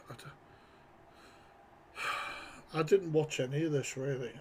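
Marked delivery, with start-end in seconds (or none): 0.1-2.0 whisper. Real, not acted. Whispered aside.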